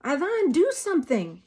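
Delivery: high, loud frantic cry; shriek. A narrator's reading. Mother shrieks at husband to act, loud. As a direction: scream for help. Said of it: "Mama shrieks".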